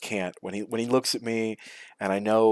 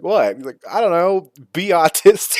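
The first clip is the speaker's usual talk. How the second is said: mock exasperated; 8 semitones higher, 6 dB louder than usual. Retold silly exchange with friend.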